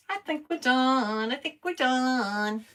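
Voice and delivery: high, slow singsong. Pretend. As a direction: drag it out teasingly. Drawn-out singsong repeat.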